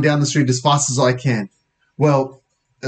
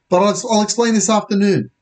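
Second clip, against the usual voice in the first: high, stumbling urgent excuse; hurried excuse; 9 semitones higher than usual. Acted. Boy putting off his friend mid-chase, high and urgent. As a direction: blurt a promise, pitched up. Andy explains while running.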